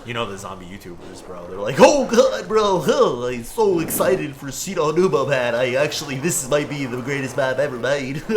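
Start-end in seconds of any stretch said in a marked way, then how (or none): 1.8-8.2 high, loud hyped-up YouTuber; overexcited streamer gushing for the camera. Retold or imitated. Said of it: "that's my YouTuber voice".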